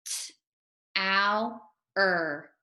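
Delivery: each sound said separately: L, ow, r. Shown as a demonstration. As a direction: sound out L, ow, r one by one, like a teacher. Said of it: "make the sounds to read it".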